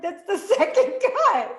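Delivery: laughing, high and loud. Retold or imitated. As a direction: laugh through it in delight. Retelling her laughing reply.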